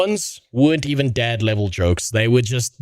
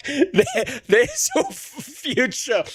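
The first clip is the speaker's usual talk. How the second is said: laughing; much slower than usual. Real, not acted. Laughing after the bit.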